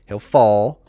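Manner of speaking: normal talking voice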